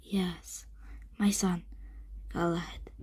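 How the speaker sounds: soft, halting answer from a weary knight; breathed reply. A narrator's reading. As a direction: say it low, with pauses. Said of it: "breathed the knight".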